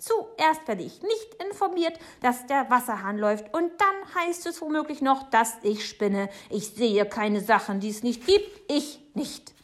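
high, loud, huffy tirade; indignant Frau Segebrecht. Acted. Neighbour defending her sanity to Papa, indignant and loud. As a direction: play an offended woman at the end of her patience.

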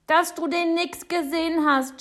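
put-on high, loud boy's shout. A narrator's reading. Boy contradicting the neighbour, shouting high. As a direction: yell it as an indignant child. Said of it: "schreit Jonathan".

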